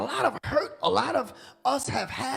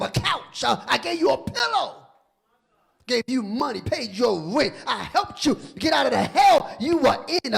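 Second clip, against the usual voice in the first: rising to a louder hurt plea; pleading betrayed man; 3 semitones higher, 7 dB louder than usual. Acted. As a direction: build from wounded to crying out. Dying man pleads with snake.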